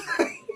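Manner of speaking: short high-pitched giggle; high laugh. Real, not acted. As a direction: burst out laughing. Burst of laughter.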